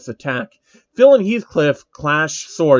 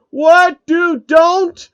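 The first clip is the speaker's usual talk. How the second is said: a much higher, loud panicked yell; panicked shout; 7 dB louder, much slower than usual. Acted. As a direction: cry out to stop it. Phil told to kill Kirito.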